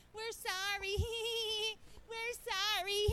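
mock sorry through laughter, high and loud; laughing fake apology. Church members laughing while apologizing to the preacher. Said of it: "you don't laugh when you're saying".

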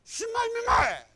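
high, loud indignant yell; angry protest. Retold or imitated. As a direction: burst out in mock outrage, voice leaping up. Protests to God in retelling.

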